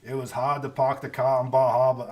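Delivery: imitating a diner's Maine accent, r dropped in park and car; a touch of Maine accent. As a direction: quote a local at the next table in a light Maine accent. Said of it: "somebody at the table next to us".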